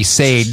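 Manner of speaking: "Sade" said as sayed, one syllable; spelling pronunciation. Shown as a demonstration. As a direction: say Sade as it is spelt, rhyming with made. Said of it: "It's, it's S A D E".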